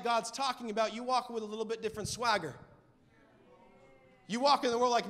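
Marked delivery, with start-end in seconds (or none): none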